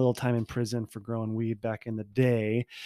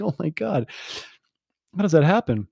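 higher, stunned oh my God; mock-shocked gasp of a question; shocked disbelief; 5 semitones higher, 5 dB louder than usual. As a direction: react in amazed disbelief. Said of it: "I'm like".

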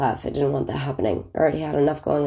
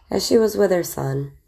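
ordinary talk

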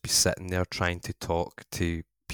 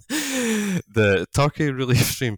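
laughing; 7 semitones higher, 6 dB louder, slower than usual. Real, not acted. Laughs at own mix-up.

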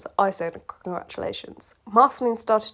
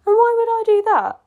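much higher and louder; emphatic line; 13 semitones higher, 7 dB louder than usual. Acted. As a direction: fling the question out. Reading Bubblegum's reply.

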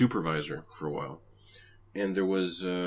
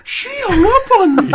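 much higher and louder, squealing in disbelief; shocked call centre guy; 17 dB louder than usual. Retold or imitated. Simpsons call centre worker telling colleagues, squealing. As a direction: wail it to your coworkers. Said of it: "he turns to his colleagues and he goes".

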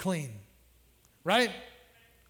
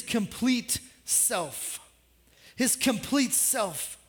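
slow emphasis; 3 dB quieter, much slower than usual. Real, not acted. Preacher slows for emphasis.

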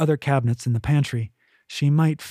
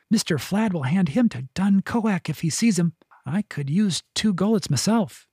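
higher, wry servant voice; second servant woman; 6 semitones higher than usual. A narrator's reading. Servant woman joking about Ollie to the others, wry. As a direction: joke about the cat with a lifted pitch.

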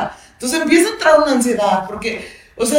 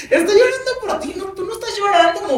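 much higher, lightly acted voice; high-pitched imitated reply, playfully pointed; mock explaining; 9 semitones higher than usual. Answering a mother who asks why baby cries, mock explaining.

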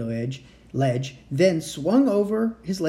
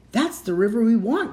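excited cry; 7 semitones higher, 4 dB louder than usual. A narrator's reading. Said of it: "cried Annie".

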